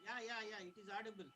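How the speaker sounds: very faint, far from the mic; almost too soft to hear; barely audible. Real, not acted. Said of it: "Actually, your voice is very low".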